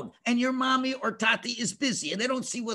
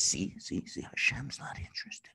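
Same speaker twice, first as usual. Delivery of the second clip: low, hushed insinuation; tempter's whisper; 11 dB quieter than usual. Acted. Tempter whispering to a child that God isn't interested, hushed. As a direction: whisper it slyly, barely voiced. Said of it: "So then the Yetzer says".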